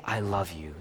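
low, very soft declaration of love; tender. Acted. Preacher voices God affirming a soul, soft and tender. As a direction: drop to a soft, intimate voice.

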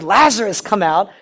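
loud, high call with "Lazarus" hit hardest. Acted. Jesus summons one dead man by name, loud commanding call. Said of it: "he specifically said".

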